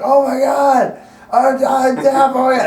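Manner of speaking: high, loud, drawn-out wail; hammed-up drunken panic. Retold drunken panic at deafness.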